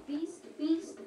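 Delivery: usual speaking voice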